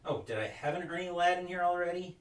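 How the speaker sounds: usual speaking voice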